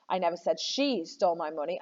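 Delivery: single out "she"; "she" carries the main stress. Shown as a demonstration. Teacher shows students how meaning shifts, stressing "she". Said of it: "I said someone took the money".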